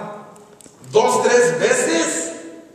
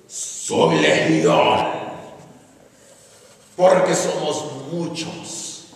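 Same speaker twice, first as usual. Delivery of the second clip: very deep, slow, terrible growl; deep and dreadful possessed voice; guttural demon voice; 7 semitones lower, much slower than usual. Acted. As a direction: draw it out in a guttural, menacing demon voice. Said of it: "con una voz gutural, una voz profunda, una voz fuerte, terrible".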